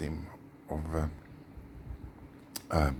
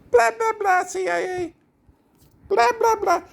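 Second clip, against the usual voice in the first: loud, piercing falsetto gibberish; mocking blabber; 12 dB louder than usual. Speaker ridicules the CIA as humorless, loud mocking squeal. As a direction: squeal it mockingly, far above your normal voice.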